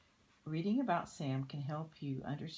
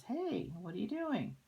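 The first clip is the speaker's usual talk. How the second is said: put-on much higher, louder kid calling out; pesky kid; 8 semitones higher, 3 dB louder than usual. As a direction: pipe up high like a nosy kid. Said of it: "the guy over here says".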